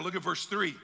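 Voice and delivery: ordinary talk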